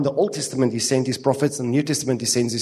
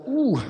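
high-pitched "ooh" at own reflection; play-acted recoil in a high voice; mock shocked "ooh"; 10 semitones higher than usual. Preacher reacting to own face on video, mock shock.

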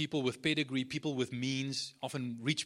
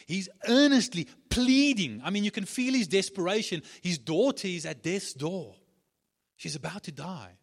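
impassioned preaching; 5 semitones higher, 8 dB louder than usual. Real, not acted. Preacher stresses desperation.

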